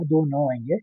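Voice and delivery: ordinary delivery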